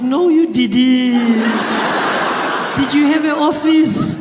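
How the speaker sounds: high, loud granny voice, very slow; disbelieving grandmother. Retold or imitated. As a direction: imitate granny dismissing it with long drawn-out vowels. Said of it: "And she's like".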